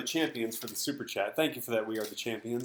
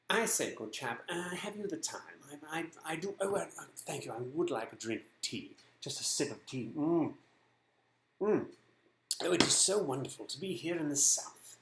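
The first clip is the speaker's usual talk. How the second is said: soft, joking southern accent; 6 dB quieter than usual. Shown as a demonstration. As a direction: put on a hushed, mock southern accent. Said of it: "do my southern accent for you".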